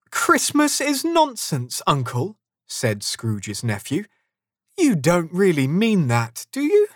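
high, incredulous echo; disbelieving protest. A narrator's reading. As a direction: question your uncle in disbelief.

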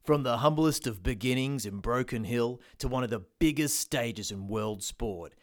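low, punchy TV promo voice; ad announcer. Acted. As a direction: sell it like a sports promo. TV ad announcer read.

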